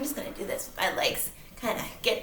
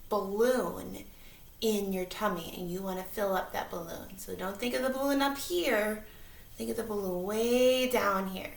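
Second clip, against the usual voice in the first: calm them down gently; kindergarten teacher voice; 9 dB quieter than usual. Acted. Leads breathing exercise.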